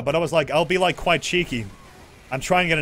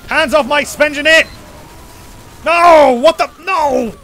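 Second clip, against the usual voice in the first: high, loud battle yells; frantic shouting; 10 semitones higher, 10 dB louder, slower than usual. Real, not acted. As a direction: scream to defend the base. Defending sentry, fighting.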